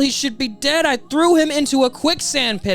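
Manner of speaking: high-pitched, panicked disbelief; acted villain losing his cool; freaking out. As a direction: rant in rising alarm, high-voiced. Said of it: "So you hear Crocodile freaking out".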